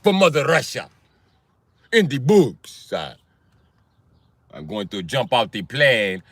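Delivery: hammed-up Russian daredevil; Russian-accented bravado. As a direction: put on a thick, proud Russian accent. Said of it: "it was a Russian".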